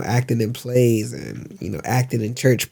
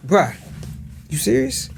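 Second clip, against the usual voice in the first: softer, higher you serious? with a long rise; unimpressed disbelief, pitched up; incredulous bruh; 6 semitones higher, 3 dB quieter than usual. Shown as a demonstration. Actor trading lines, soft high disbelief. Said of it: "we'll say it different ways".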